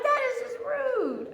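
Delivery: high-pitched offended protest; comic high-voiced indignation; mock indignant. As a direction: squeak out a mock complaint. Acts own protest to friend.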